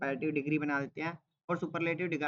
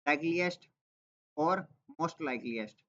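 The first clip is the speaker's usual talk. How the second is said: slow answer reading; slower than usual. Shown as a demonstration. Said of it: "एक तो बनता है".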